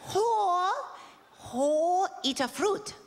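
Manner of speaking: slow mock accent, laughing at the end; mock Japanese accent. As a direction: coax slowly like a tiny royal. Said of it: "she goes like this".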